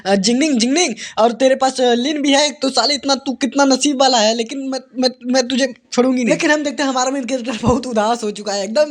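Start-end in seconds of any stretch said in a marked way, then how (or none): none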